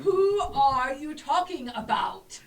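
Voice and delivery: loud, high, drawn-out pompous bellow; pompous imitation. Player mimicking the knight to fool him, pompous booming. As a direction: boom it out slowly like a self-important knight. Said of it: "try to sound as pompous as him".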